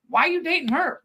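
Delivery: high-pitched, put-out questioning; mock jealous whine. Girl confronting the guy she friend-zoned, mock put-out.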